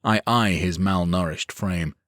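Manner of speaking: ordinary delivery, nothing marked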